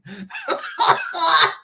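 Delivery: real high-pitched giggling laugh; high burst of laughter. Real, not acted. Streamer teasing Brian about slow writing, cracking up. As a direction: crack up at your own jab. Laughs at his own jab.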